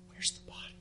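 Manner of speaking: soft, searching whisper. Acted. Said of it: "She just says".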